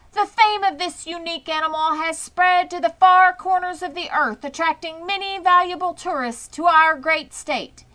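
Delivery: grand loudspeaker voice; fair announcer. A narrator's reading. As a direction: boom it out to the whole fairground.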